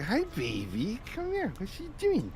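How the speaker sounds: put-on high, sweet baby-talk to a cat; kitty cat voice. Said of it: "a little kitty cat voice for her".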